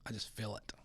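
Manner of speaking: breathy ominous whisper. Real, not acted. Whispering to listeners that canceling is coming. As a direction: hush it like a warning.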